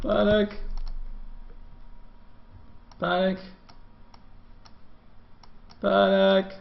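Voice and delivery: high-pitched, spaced-out cries; mock panic. Panic repeats into dead air.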